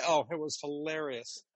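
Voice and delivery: ordinary talk, nothing marked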